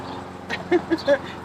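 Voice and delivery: high unvoiced giggle; a delighted little laugh; breathy giggle. Real, not acted. Watching the leaf move, giggling with delight.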